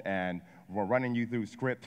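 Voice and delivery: ordinary talk, nothing marked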